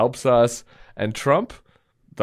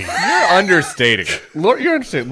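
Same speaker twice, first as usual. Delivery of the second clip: excited teasing; 8 semitones higher, 6 dB louder, faster than usual. Real, not acted.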